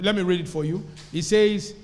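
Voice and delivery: normal talking voice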